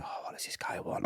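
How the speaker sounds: whispered, weary inner thought; muttered aside. Retold or imitated. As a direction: grumble it under your breath. Private thought as inmate approaches.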